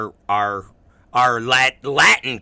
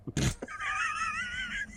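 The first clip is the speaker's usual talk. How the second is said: a sudden squealing laugh, much higher; high burst of laughter; 14 dB quieter than usual. Real, not acted. Laughing at his own story about Mins, high squealing laugh. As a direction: crack up at your own punchline.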